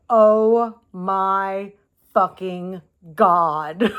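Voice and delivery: slow, drawn-out awestruck exclamation; real amazement. Real, not acted. Flipping the stone over for viewers, slow stunned outburst. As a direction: stretch every word out in amazement.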